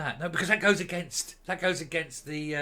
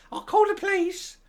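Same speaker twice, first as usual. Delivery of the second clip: shrill high falsetto threat, louder; 7 dB louder than usual. Retold or imitated. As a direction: squeal it like a hammed-up outraged diva.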